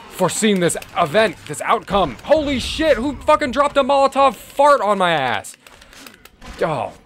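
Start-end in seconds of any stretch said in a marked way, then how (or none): 2.2-5.6 shocked gamer shriek; startled yell. Real, not acted. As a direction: yelp it out, caught off guard. Sudden attack mid-fight.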